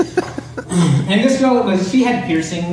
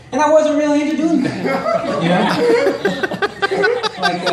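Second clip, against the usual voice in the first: high breaking laugh; cracking up mid-sentence; laughing through words; 12 semitones higher, slower than usual. Real, not acted. Laughing at dark punchline.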